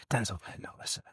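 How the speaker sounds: barely audible, halting whisper; Spike Lee whispering. Retold or imitated. Director privately nudging his star between takes, whispered imitation.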